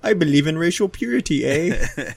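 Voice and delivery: lightly hammed Canadian accent, ending on eh; mock Canadian accent. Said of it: "Canadian Nazi".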